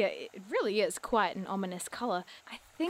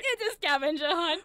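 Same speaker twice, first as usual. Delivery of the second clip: louder gleeful announcement; triumphant; 6 semitones higher, 4 dB louder than usual. Real, not acted. Friend gloats her guess was right, triumphant. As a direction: crow it in triumph.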